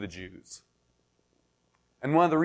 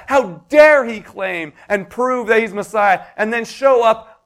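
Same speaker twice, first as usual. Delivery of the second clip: loud, slow, higher cry of fury; angry crowd yell; 7 semitones higher, 8 dB louder, slower than usual. Retold or imitated. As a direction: yell it like an enraged mob. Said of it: "they yell".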